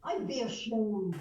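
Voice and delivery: slow, loud Irish punchline; old pub regular's emphatic Irish reply; Irish accent. Shown as a demonstration. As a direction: declare it in a drawn-out Irish brogue. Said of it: "let's see if I can do it".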